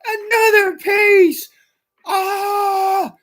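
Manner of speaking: high, wailing fake sob; mock anguished wail. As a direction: howl it like a cartoon heartbreak. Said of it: "I was really pissed about 'em".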